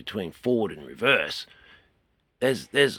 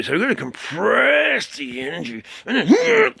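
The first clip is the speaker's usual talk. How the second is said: strained cry, jumping much higher and louder; kung fu cry; 10 dB louder than usual. Shown as a demonstration. Kung fu teacher compressing energy into his head, crying out.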